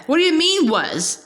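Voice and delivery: a stern question, pitch jumping high on the first word. A narrator's reading. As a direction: banker demanding an answer, stern. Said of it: "Mister Poe asked sternly".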